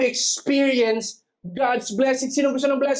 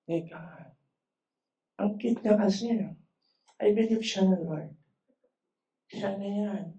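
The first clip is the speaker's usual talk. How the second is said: hushed, much softer aside to God; private lovestruck prayer; 6 dB quieter than usual. Acted. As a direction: confide a crush to God under your breath. Acted infatuated prayer about a crush. (pabulong na dasal ng kinikilig)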